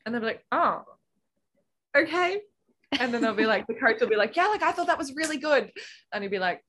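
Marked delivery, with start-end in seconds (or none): 0.5-2.4 high, halting imitation of a puzzled coach; taken-aback coach. Coach hearing the swimmer is unhappy, imitated puzzled reply. 4.3-5.7 high-pitched imitated coach praise; encouraging coach. Coach reassuring a doubting swimmer, imitated encouragement.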